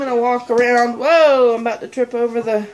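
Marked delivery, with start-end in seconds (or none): 1.0-1.5 surprised. Real, not acted. About to trip over cord.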